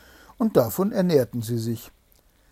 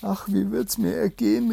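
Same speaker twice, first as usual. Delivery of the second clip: higher, distressed princess voice; a lightly voiced frightened princess, higher; crying out; 8 semitones higher than usual. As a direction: wail it in worry, pitched up. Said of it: "rief sie".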